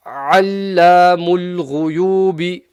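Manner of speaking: ALLAMUL GHUYUBI slow, loud, guttural gh; throaty recitation. Shown as a demonstration. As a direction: recite ALLAMUL GHUYUBI with long vowels, clear ayn and ghain. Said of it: "guttural sound".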